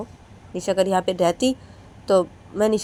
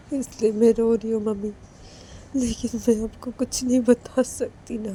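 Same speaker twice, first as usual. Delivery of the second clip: teary pleading; 4 semitones higher than usual. Acted. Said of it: "Manisha फिर से रोने लगती है".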